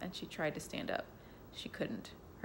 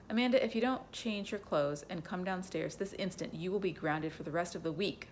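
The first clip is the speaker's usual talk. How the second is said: ordinary delivery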